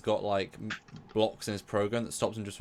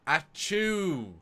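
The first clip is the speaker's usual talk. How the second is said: high, spoken-out achoo, drawn long; Brent's blunt achoo; 8 semitones higher than usual. Retold or imitated. Host imitates actor's Data sneeze, blunt high achoo. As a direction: say the sneeze as a bare word. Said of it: "the most blunt saying it".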